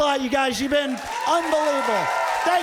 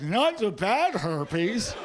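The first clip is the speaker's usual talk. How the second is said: slow, rising, incredulous echo; patient realizing what the doctor really means; dawning realization; 3 semitones lower, much slower than usual. Retold or imitated. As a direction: let it dawn on you word by word. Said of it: "all of a sudden it dawns on me".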